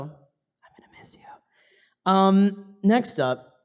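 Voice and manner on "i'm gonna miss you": whispered. Real, not acted. Emotional thanks to her sister.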